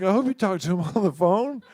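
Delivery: high, laughing sarcastic reply; laughing disbelief. Retold or imitated. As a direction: laugh it out in doubt. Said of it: "I was like".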